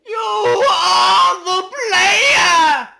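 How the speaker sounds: slow, high, stretched shout; shouted climax. Acted. Hollering the last line of the poem at the player. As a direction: yell it out long and high.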